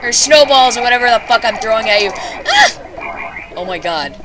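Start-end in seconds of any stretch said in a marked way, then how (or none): 2.2-3.5 high panicked scream. Real, not acted. Gamer panicking at monster.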